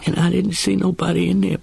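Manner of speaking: ordinary delivery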